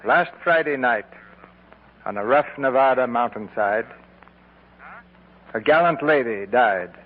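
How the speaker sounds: honor a fallen star with dignity; formal radio eulogy, measured and grave; solemn tribute. Acted. Solemn memorial tribute on air.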